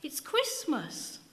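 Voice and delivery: put-on slow, high, proud child; a slow, sure child's voice; small boy. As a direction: spell it out like a certain little boy. Boy repeats his answer.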